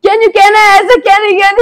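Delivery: high shouted rushed twister; loud fast shout. Shown as a demonstration. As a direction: yell it out as fast as possible. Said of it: "really fast".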